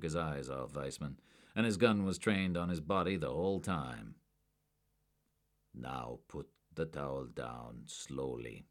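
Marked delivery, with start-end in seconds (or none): 5.7-8.7 slow, soft and deliberate; low steady order. A narrator's reading. Agent ordering a suspect, slow and low. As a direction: hold him still with a slow calm command. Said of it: "Werner ordered again".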